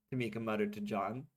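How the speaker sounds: ordinary delivery, nothing marked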